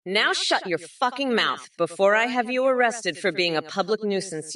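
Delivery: ordinary talk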